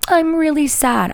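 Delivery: high, drawn-out pretend sadness; slow, high-pitched mock pout; cute mock sadness. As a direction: put on a dainty little "sad" voice. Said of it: "a cute one".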